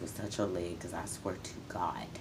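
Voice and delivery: hushed threat under the breath; menacing whisper. Real, not acted. Mother threatening her daughter's abuser in a whisper. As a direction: swear it low and dangerous.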